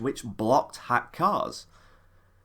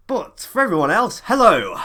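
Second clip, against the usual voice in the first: loud greeting; 5 semitones higher, 7 dB louder than usual. Acted.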